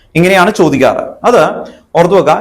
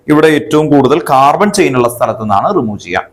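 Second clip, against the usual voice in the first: ordinary delivery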